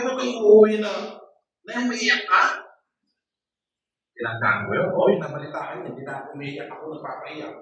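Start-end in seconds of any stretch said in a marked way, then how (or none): 0.0-2.4 much louder parent warning; scolding shout. Retold or imitated. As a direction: yell it like a strict parent. Parent scolding crying child.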